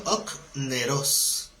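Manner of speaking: very slow "okneros", stress on the second O; "okneros" stretched for the class, final syllable stressed; Greek word pronunciation. Shown as a demonstration. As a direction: spell out "okneros" by sound, leaning on the last O. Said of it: "con acento en la segunda O".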